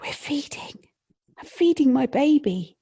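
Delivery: a mum amazed she can finally feed; overjoyed new mum. Retold or imitated. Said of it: "And she'll say".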